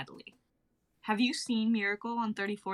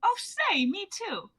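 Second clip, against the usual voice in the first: delighted; 10 semitones higher, 4 dB louder, slower than usual. Acted. Delighted at a shared age.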